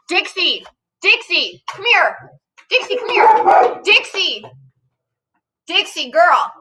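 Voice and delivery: high, rising calls of the dog's name; coaxing the barking dog back; calling the dog. Real, not acted.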